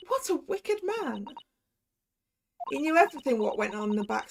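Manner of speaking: lightly put-on Cockney accent, confessing; a street girl's Cockney, owning up; Gina's Cockney. Gina confessing her false testimony, light Cockney accent. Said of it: "my attempts as a Cockney accent".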